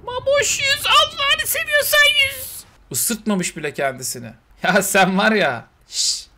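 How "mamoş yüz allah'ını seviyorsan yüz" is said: high pleading shout, slow on the second line; high-pitched pleading call. Real, not acted. To his pet Mamoş, begging it to swim: high pleading call.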